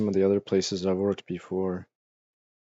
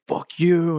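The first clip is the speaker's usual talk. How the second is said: mock angry retort; 7 semitones higher, 3 dB louder than usual. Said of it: "his answer's like".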